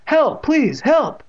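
high, loud cry for help; panicked cry. A narrator's reading. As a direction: yelp for help in alarm. Boy attacked, calls for help.

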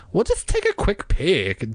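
high-pitched coaxing; insisting. A narrator's reading. Diane talking Margaret into the basement, insisting. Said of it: "Diane insisted".

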